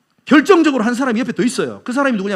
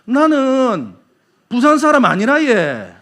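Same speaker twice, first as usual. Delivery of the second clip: higher, louder Busan dialect with drawn-out endings; Busan accent; 4 semitones higher, 5 dB louder, much slower than usual. Shown as a demonstration. Busan native denying being from Busan, Busan accent. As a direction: deny it in broad Busan dialect. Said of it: "부산 사람이 부산 사람 말투로 뭐라고 말하냐".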